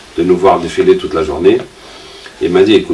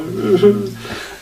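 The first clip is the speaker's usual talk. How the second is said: high laugh; 13 semitones higher, 3 dB quieter than usual. Real, not acted. Laughs at own misery.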